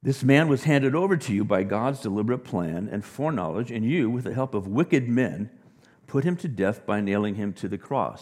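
loud, ringing scripture reading; preacher booming out the apostle's words; preaching scripture. Acted. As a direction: proclaim it to the crowd. Preacher reads Acts sermon loudly.

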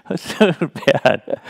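slow, amused and laughing; real amused laugh; laughing at himself. Real, not acted. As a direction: chuckle through the words at your own folly. Laughing at his own teenage move.

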